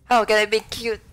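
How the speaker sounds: loud, high, indignant squeak; high-pitched Natsuki voice. Acted. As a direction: squeal it in offended disbelief. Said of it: "do the high-pitched voice for Natsuki".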